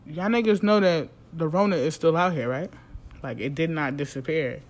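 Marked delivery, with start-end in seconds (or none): none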